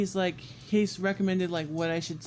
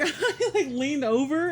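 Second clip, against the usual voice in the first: laughing; 8 semitones higher, 4 dB louder, slower than usual. Real, not acted.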